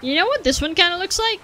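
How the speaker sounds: normal talking voice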